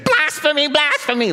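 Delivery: high-pitched mock cry of accusation; outraged crowd. Crowd accusing Jesus of forgiving sins, mock outrage. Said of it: "And then they would say".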